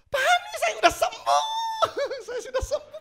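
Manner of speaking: very high, laughing, loud voice; joyful woman. Retold or imitated. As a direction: burst out with happy news. Healed woman joyfully reports. (ibu tertawa bahagia sudah sembuh)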